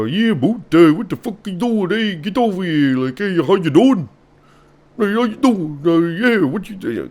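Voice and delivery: high, garbled mash-up of Canadian and Italian accents; hammed-up Canadian-Italian hybrid accent. Shown as a demonstration. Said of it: "if you combined both of those accents".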